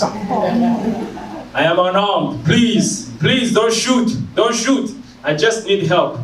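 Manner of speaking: hammed-up frightened pleading; acted pleading. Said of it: "Actually, I started to speak in African".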